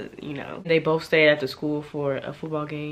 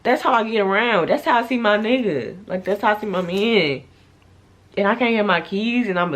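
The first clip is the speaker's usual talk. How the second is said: upset rant; 5 semitones higher, 4 dB louder than usual. Real, not acted. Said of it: "I was so sick".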